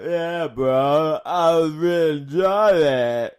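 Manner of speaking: slow, slurred mock stoner; hammed-up dopey bro drawl; Matt Riddle. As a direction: sound like Rocky after a joint. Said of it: "You sound like Rocky's had a joint".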